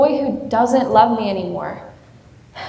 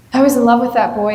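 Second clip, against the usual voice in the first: put-on "I was in love with that boy" pushed out faster with weight on "was"; insistent repeat; 3 dB louder, faster than usual. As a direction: insist on "was", quicker and fuller. Repeats the confession with force.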